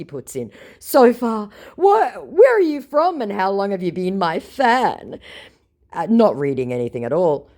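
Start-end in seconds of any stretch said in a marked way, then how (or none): 0.8-5.2 higher, eager fake-fan questioning; squeaky overfriendly con man asking questions; hammed-up scammer voice. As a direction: play the con man fishing for details. Scammer's chat message voiced.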